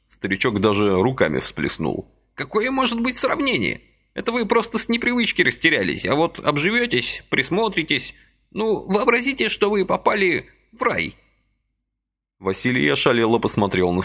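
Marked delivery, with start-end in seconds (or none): 2.3-11.1 put-on high fussy old man's voice, gently reproaching; a little old man chiding kindly; old man reproaching Vasily for his haste, high and fussy; reproachful old man. A narrator's reading.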